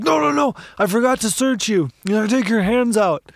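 mock the cop catching himself; storyteller voicing the flustered cop, high pitched. Cop catching his mistake.

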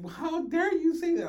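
high, loud mock outrage; angry old lady. Old lady scolding the manager, mock furious. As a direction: imitate her scandalized outburst. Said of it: "she was so angry".

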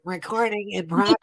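stiff, even robotic announcement; computer voice. Retold or imitated. Mocking the Zoom recording announcement she wants to replace. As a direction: mimic the Zoom recording notice like a machine. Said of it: "a computer voice".

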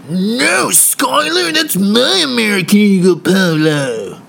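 high, loud whining protest; high-pitched bratty squabble; whiny brother. Retold or imitated. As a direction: whine back at your brother. Brothers arguing, voice rising.

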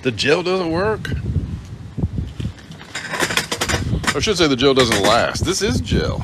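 hammed-up frustration at the gel; excited. Real, not acted. Fussing at hair, animated.

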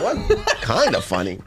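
squeaky high what through a laugh; mock offended at kinda funny; laughing disbelief. Reacting to backhanded compliment.

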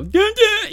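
high, loud mock-dramatic "dun dun"; teasing "dun dun". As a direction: sing out two goofy notes to annoy. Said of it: "walk by the class every day and go".

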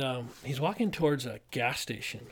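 usual speaking voice

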